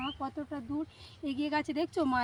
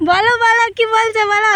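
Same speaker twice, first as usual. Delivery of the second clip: loud call across the field; 7 semitones higher, 14 dB louder than usual. Real, not acted. Girl calling loudly to her distant mother in the field. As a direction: shout so mother hears far away.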